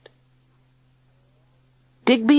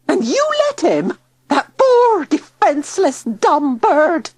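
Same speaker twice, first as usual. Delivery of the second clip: very high, loud, furious shriek; outraged shriek; 12 semitones higher, 5 dB louder than usual. A narrator's reading. Tilly shrieking at Digby for letting bird go. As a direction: rage at him, appalled.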